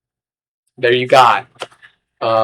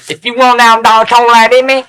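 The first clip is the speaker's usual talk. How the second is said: high, loud, garbled Southern imitation; attempted Southern accent; 10 semitones higher, 6 dB louder than usual. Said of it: "That's more like Southern".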